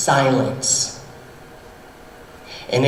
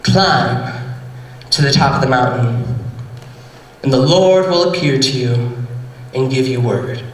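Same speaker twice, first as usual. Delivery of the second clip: calm, lower command; lightly low divine voice; 6 semitones lower than usual. Acted. The Holy Spirit instructing Elijah, calm and a bit lower. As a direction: speak as the Spirit, steady.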